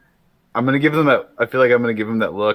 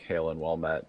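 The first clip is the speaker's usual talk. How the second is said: low, soft repeat of a greeting; quiet, low-pitched hail in character; Draconic greeting; 5 semitones lower, 10 dB quieter than usual. Acted. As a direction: drop the pitch and volume right down. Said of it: "say it again in Draconic".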